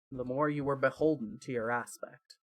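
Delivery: ordinary delivery, nothing marked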